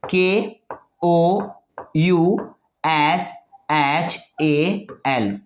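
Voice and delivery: letters read slowly and separately; slow spelling. Shown as a demonstration. Teacher spelling the Bengali way, slowly. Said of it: "तो वो ऐसे लिखेंगे".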